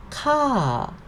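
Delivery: "ka" starting high, then falling. Shown as a demonstration. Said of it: "falling sound".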